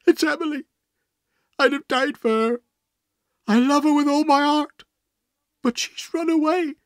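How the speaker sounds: very high, anguished wail; distraught man crying out his grief; wild sobbing. A narrator's reading. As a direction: pour out heartbreak, voice pitched right up. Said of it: "he sobbed wildly".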